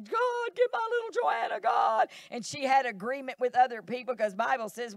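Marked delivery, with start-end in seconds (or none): 0.0-2.1 high, loud wailing plea to God; mother's crying plea. Retold or imitated. Mother wailing to God for her runaway daughter, crying loud. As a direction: cry out to God like the weeping mother.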